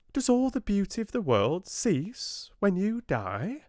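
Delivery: high, piping tiny fly voice; little fly. A narrator's reading. Tiny fly questions the oak, high piping voice. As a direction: squeak it out like a tiny insect.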